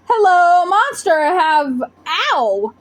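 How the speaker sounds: the hurt unicorn calling to the monster; hurt giant unicorn. Acted. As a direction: call out in pain.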